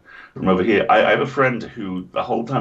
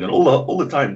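usual speaking voice

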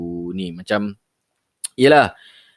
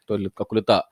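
usual speaking voice

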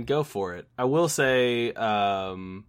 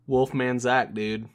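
ordinary talk

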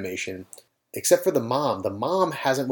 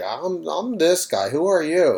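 groggy pilot; 4 semitones higher, slower than usual. Retold or imitated. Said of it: "He's like".

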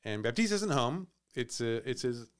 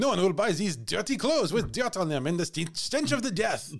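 French chef; 5 semitones higher, 3 dB louder than usual. Pretend. Voicing Jean-Baptiste.

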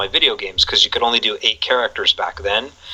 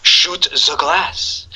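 very slow, drawn-out villain line; Alan Rickman; 5 semitones higher, much slower than usual. Retold or imitated. Fan quoting the villain's Die Hard line, slow impression. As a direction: stretch out each word as a movie villain. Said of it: "Huge shout out to Alan Rickman".

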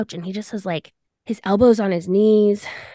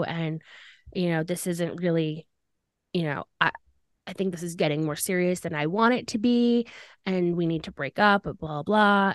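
low, subdued imitation of a boyfriend; retold breakup speech; 4 semitones lower, 6 dB quieter than usual. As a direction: softly recount his awkward breakup lines.

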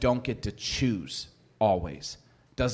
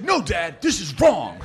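indignant kid talking back, imitated; higher-pitched mock outrage; mad son's tone; 5 semitones higher, 4 dB louder, slower than usual. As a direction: play a son sternly correcting his dad. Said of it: "Son, watch your tone".

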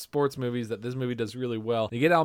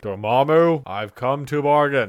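slow, louder, grave hero line; movie quote impression; 5 dB louder, slower than usual. Quoting Doctor Strange to a co-host, slow and grave. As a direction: intone it like a solemn sorcerer.

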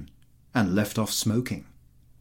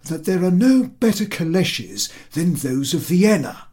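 put-on much higher, keen young officer; eager colonel; 8 semitones higher, 7 dB louder than usual. A narrator's reading. The colonel chiming in to the general, much higher. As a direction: pipe up high and keen to please the general.

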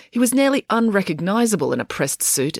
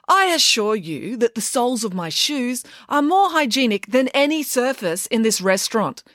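high, indignant declaration; haughty retort; 8 semitones higher than usual. Acted. April on the table to the waitress, haughty. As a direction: declare it with lofty disdain.

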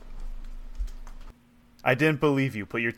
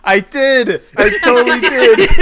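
excited; 11 semitones higher, 13 dB louder, slower than usual. Real, not acted. Excited answer about DVD.

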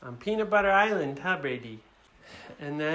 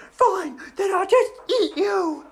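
high, loud dragon retort; threatening girl dragon; 13 semitones higher, 5 dB louder than usual. Acted. Dragon threatens to eat the kids, high loud huff. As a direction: threaten them in a raised girly voice. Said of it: "And she said".

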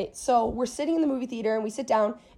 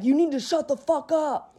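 mildly raised, higher mock yell; half-voiced yell at a girlfriend; retold yelling; 4 semitones higher, 3 dB louder than usual. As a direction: imitate an angry boyfriend's telling-off. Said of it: "He's literally yelling at her".

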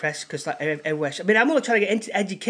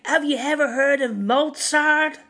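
higher, louder, hammed-up angry American; mock angry shout; 8 semitones higher, 4 dB louder than usual. Drunk park guy yelling at the street musician, mock angry. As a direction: play the drunk yelling at the busker. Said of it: "He goes".

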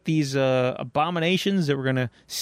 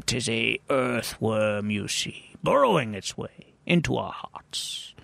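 mock grand storyteller; 6 semitones lower, slower than usual. Joke narrator reveals earthworm.

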